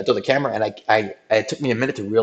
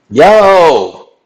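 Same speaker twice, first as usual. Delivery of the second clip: high, loud "Yo!" to the stream; loud shout-out; 6 semitones higher, 11 dB louder than usual. Real, not acted. Streamer shouting to his viewers, high and loud. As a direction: call out to the viewers. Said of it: "loud stream sign-off call".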